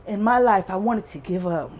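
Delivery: ordinary talk, nothing marked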